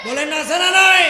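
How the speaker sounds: loud shouted question to the crowd; insistent rallying call. Real, not acted. Preacher pressing congregation to reply, insistent shout. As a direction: press them hard for an answer. Repeats louder, demanding audience answer.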